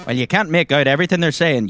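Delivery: normal talking voice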